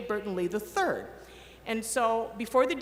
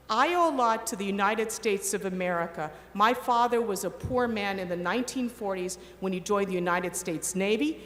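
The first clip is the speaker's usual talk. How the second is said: ordinary talk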